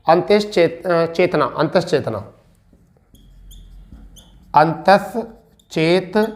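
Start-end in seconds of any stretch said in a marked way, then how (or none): 4.5-6.3 each syllable slowly drawn out; slow dictation. Shown as a demonstration. Teacher dictating numbers to students, slow and drawn out. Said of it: "समझ पा रहे होगे इसको".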